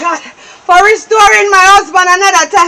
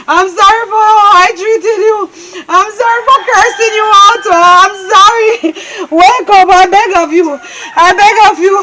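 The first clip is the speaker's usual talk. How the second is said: tearful begging for forgiveness; sobbing apology. Acted. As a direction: plead and sob. Wife sobbing apology to husband.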